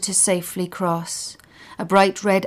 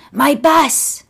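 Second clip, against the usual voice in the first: put-on old man; 8 semitones higher, 7 dB louder than usual. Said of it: "says Leighton".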